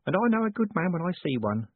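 high, genteel woman's character voice; sweet lady. Acted.

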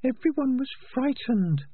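very high, thin rat voice; tiny high-pitched rat, pointed; prim female rat. Acted. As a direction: squeak it out as a small rat. Peaches the rat's put-on voice.

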